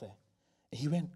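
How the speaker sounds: plain reading voice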